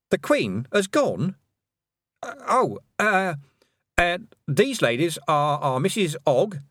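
put-on high, stammering young girl, thrown; startled girl. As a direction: caught off guard, stumbling over words.

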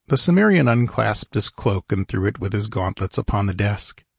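plain reading voice

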